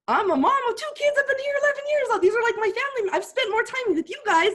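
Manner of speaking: high distressed plea. Retold or imitated. To the bosses walking her out, a high loud desperate plea. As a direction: protest to them, voice climbing high.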